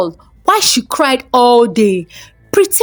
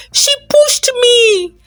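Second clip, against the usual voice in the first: much higher, ringing repeat of the charge; loud accusation; 13 semitones higher than usual. A narrator's reading. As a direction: cry it out so the whole crowd hears. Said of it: "Jasmine said loudly".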